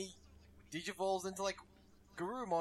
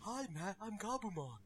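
soft, really breathy character voice; breathy creature impression; Gabumon; 6 dB quieter than usual. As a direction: breathe the words out, hushed and airy. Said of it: "it's really breathy".

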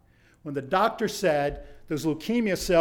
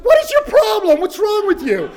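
high, loud indignant shout; exasperated yell; 8 dB louder than usual. Retold or imitated. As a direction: demand an answer, fed up. Confronting girl after finish.

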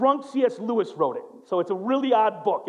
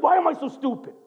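self-reproach; 6 semitones higher than usual. Retold or imitated. Husband berating himself in the mirror, mildly raised. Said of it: "Looks in the mirror like".

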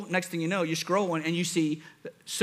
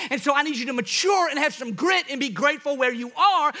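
preaching at full force; 5 semitones higher than usual. Acted. Pastor warning the congregation against comparison, full-force preaching. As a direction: drive the message home, pressing hard.

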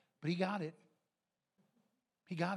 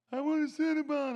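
put-on high, loud child demand; insistent kid nagging; whiny kid; 9 semitones higher, 8 dB louder than usual. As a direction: beg like a stubborn boy. Kid begging again.

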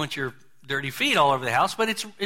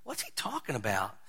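puzzled mutter; 3 semitones lower, 10 dB quieter than usual. Retold or imitated. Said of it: "Peter scratching his head saying".

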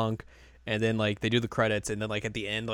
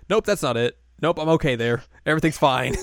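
higher, louder brisk dismissal; voiced Chica; 4 semitones higher, 6 dB louder than usual. Chica brushing off every fortune offered, higher and dismissive. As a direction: wave each one off in a raised voice.

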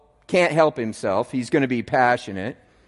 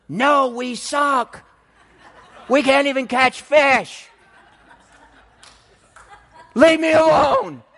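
laughing self-mockery; 12 semitones higher, 5 dB louder than usual. Fishermen answer, laughing, self-mocking.

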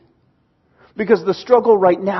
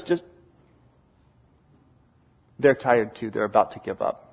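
low, quiet fatherly reassurance; calm dad; 9 semitones lower, 7 dB quieter than usual. Retold or imitated. Dad reassuring his tired son, low and calm. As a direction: answer the protest low and softly. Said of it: "He goes".

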